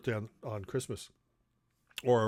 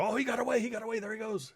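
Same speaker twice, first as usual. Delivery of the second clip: much higher mock alarm; dad faking alarm; 9 semitones higher, faster than usual. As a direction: pretend the brownie just escaped. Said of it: "my dad would go".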